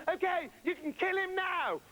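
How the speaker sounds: high, called-out shout as he runs off. Acted. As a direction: yell it back over your shoulder, high.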